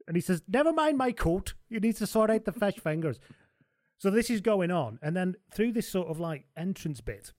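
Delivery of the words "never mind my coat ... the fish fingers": Humphries voice. Retold or imitated. Humphries voice, pitched high.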